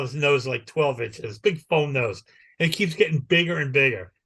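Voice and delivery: usual speaking voice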